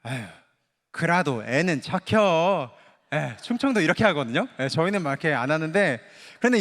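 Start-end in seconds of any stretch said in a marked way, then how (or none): 0.0-2.7 slow, mild Chungcheong drawl; easygoing Chungcheong mom. Retold or imitated. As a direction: lightly shrug it off like a laid-back Chungcheong mother. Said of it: "딱 한마디만 해요". (느리고 느긋한 충청도 사투리 엄마)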